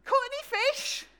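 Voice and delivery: a chirpy call from the shore; cheery stranger calling. Retold or imitated. Stranger on land calls out.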